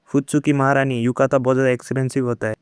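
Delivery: ordinary delivery, nothing marked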